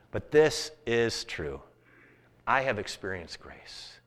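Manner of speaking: slow down and let it land. Acted. Preacher turns to quiet testimony.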